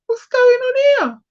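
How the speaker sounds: high-pitched mock bewilderment; retold puzzlement. Retells own puzzled reaction on waking.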